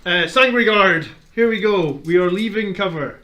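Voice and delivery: loud and high, rallying the troops; hyped battle cry. Acted. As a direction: call the charge with gusto. Hyping up a charge.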